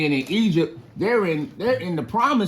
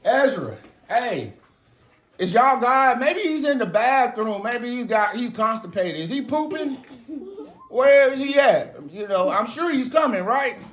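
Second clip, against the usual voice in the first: high, mocking prophet's taunt; confident taunting; 10 semitones higher, 3 dB louder than usual. Elijah struts around mocking Ezra's absent god, confident taunt. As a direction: taunt them in a raised, strutting voice. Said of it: "is confident".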